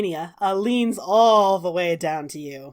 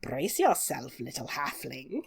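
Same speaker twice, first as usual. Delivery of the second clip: low, soft, sinister hag voice; menacing hag; 7 semitones lower, 11 dB quieter than usual. Acted. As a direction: purr it like a cruel witch. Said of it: "And she says".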